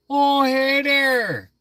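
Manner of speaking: full-on Minnesota caricature; exaggerated Fargo accent. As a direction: stretch every vowel like in Fargo. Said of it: "Like that movie Fargo".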